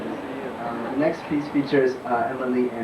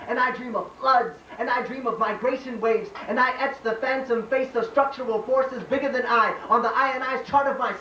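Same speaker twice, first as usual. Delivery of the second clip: spoken-word poem; 11 semitones higher than usual. Acted. Poet tells America of a mother's hands, declaimed spoken-word poem. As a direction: slam poet's raised-pitch declaiming.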